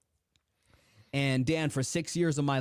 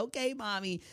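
put-on small, softer, higher child voice; little boy; 6 semitones higher, 5 dB quieter than usual. Second grader answering his mom, small high imitated voice. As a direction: imitate a second grader softly agreeing, pitched up. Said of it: "I was only in the second grade".

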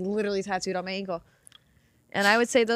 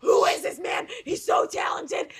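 excited shouting; 6 semitones higher than usual. Retold or imitated. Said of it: "I was like".

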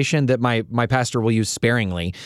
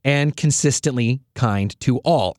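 ordinary talk, nothing marked

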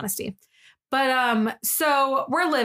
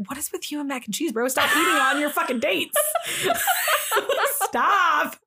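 laughing scold; 5 semitones higher than usual. Retold or imitated. Scolding friend while laughing.

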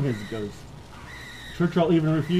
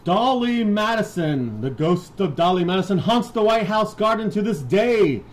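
spooky announcement; 6 semitones higher, 6 dB louder than usual. Acted.

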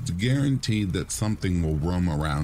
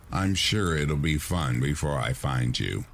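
ordinary delivery

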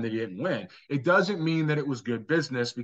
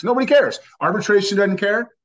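higher, louder, hammered home; loud and final, full force; emphatic shout; 5 semitones higher, 7 dB louder than usual. Real, not acted. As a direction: shout it to end the argument. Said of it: "I wanna say this with emphasis".

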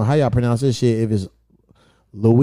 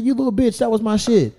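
pitched up, playful fake fury at a friend; mock outraged; 8 semitones higher than usual. To Igor over the drunk vodka, mock outraged and high. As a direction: scold him like he stole your stash.

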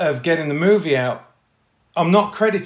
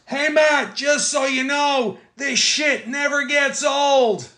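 shouting team guy; 9 semitones higher, much slower than usual. Retold or imitated. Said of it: "turns and he goes".

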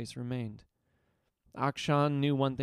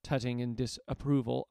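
ordinary delivery

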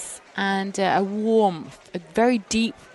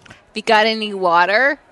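louder, put-on Boston accent; Boston accent attempt; 7 dB louder than usual. Shown as a demonstration. Englishwoman playing a caller, attempted Boston accent. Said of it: "It's Emma from Boston".

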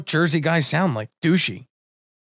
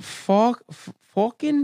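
put-on tentative Maine accent on fucking, a bit higher; Maine accent attempt; 4 semitones higher, much slower than usual. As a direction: try out a Maine accent, unsure. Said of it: "the worse the impression gets".

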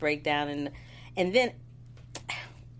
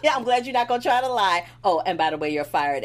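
mock firing; 2 semitones higher, 7 dB louder than usual. Co-host retells Jax firing Curtis, loud mock firing. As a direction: act out firing him with relish.

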